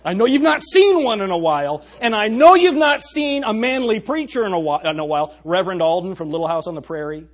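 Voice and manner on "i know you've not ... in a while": high, loud preacher's outburst; emphatic preaching. Real, not acted. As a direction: hammer it home to the congregation, raised voice. Preacher rebukes the culture at full force.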